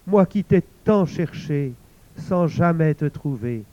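put-on old woman mourning over the head, loud lament; weeping lament. A narrator's reading. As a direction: cry his name in grief. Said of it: "se mit à pleurer".

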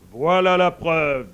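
loud, drawn-out declaration; triumphant sun. Acted. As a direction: proclaim it slowly and loudly. Sun triumphantly points out proof.

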